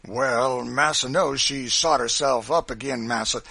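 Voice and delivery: aggrieved henchman; Sambo's self-defence. A narrator's reading. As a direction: protest emphatically to the boss. Sambo defends himself to master.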